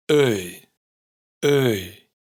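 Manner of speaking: "Öi" empezando en Ö y cerrándose a I; diptongo islandés. Shown as a demonstration. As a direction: deslizar "Öi" de Ö hacia I abierta. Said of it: "Empieza con el sonido de Ö". (diptongo "Öi" de Ö a I)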